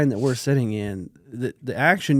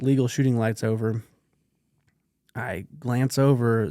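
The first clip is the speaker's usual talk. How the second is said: usual speaking voice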